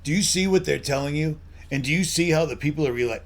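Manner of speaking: ordinary talk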